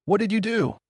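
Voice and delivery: high, sharp cry of shock. A narrator's reading. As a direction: blurt it out, stunned and alarmed. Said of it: "the boy cried out in shock".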